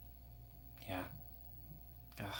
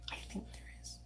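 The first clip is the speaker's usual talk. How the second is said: whisper; 23 dB quieter, faster than usual. Real, not acted. Repeated whispered aside.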